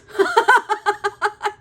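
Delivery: loud, high-pitched cackling laugh; helpless loud giggles; burst of laughter. Real, not acted. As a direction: crack up laughing hard. Amused by her own story.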